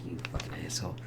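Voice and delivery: hushed whispered insult under the breath; mock whispered outrage at the villain; whispered curse. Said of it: "It's like".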